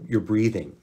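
normal talking voice

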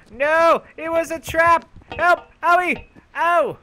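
high, loud, hammed-up shrieks of alarm; mock panic. Gamer whose character got trapped, hammed-up panicked yelling. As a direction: shriek like you fell into a trap.

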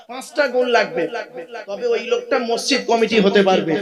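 loud, driving sermon voice; fiery preaching. Acted. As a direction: preach it out to the crowd. Preaching at full force. (উচ্চস্বরে জোরালো ওয়াজ)

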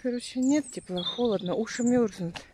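ordinary talk

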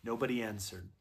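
plain reading voice

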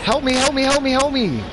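desperate high pleading screams; terrified horror victim. Acted. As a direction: wail for someone to save you. Pleading for rescue in game.

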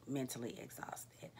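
whispered echo of mentally exhausted. Real, not acted. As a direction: let it fall to a whisper. Final repeat whispered.

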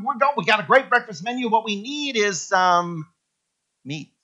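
ordinary talk

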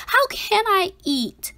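ordinary delivery, nothing marked

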